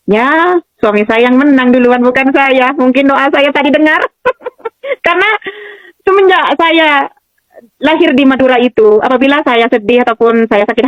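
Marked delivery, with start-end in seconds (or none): 4.1-5.0 high, soft chuckling; real laugh at your own remark; amused laugh. Real, not acted. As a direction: break into a high giggle. Laughs at her answered-prayer remark.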